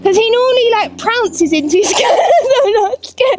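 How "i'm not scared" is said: nervous laughing denial. Real, not acted. Nervous rider before cantering.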